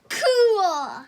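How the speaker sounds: loud, delighted cry; excited cheer. Real, not acted. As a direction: shout it out thrilled. Both kids cheer the giant's fall.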